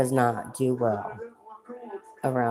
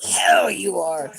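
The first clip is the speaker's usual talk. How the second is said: loud, slow, defiant shout; loud slow shout at the doctor refusing forceps; 4 semitones higher, 8 dB louder, slower than usual. Retold or imitated.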